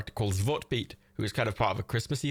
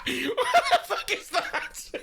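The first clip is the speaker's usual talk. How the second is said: laughing shock; much slower than usual. Real, not acted. A weird image in chat.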